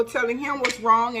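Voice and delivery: normal talking voice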